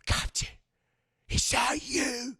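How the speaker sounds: high, drawn-out mock reverence; hammed-up emotional reunion; awed pirate rat. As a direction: greet a long-lost captain in wonder. Pirate rat greets returned captain.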